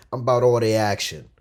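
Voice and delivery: ordinary talk, nothing marked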